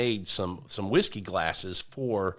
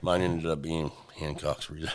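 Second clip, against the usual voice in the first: low, soft mocking imitation of a letdown; mock disappointed; 5 semitones lower, 5 dB quieter than usual. Teasing co-host over his whiskey pick, mock disappointed. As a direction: mimic them sounding glum, lower and quieter. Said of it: "Like you're disappointed you picked that".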